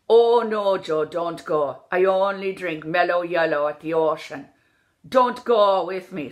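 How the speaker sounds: "go" stretched almost to "gaw"; Irish pure O. Shown as a demonstration. Coach drilling the vowel, "go" like "gaw". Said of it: "So it's almost like gaw".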